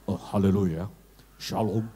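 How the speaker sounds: low, put-on preacher bass; mock-solemn deep greeting; bassy pulpit preacher. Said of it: "Kalau di mimbar agak ngebas".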